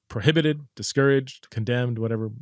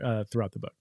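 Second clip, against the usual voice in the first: ordinary talk, nothing marked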